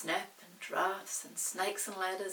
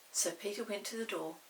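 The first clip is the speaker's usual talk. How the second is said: ordinary delivery, nothing marked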